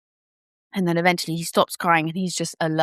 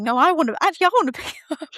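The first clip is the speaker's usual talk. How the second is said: high, fast, eager insisting; eager insistence; 10 semitones higher, faster than usual. Retold or imitated. New mother insisting on holding her baby, quick and high.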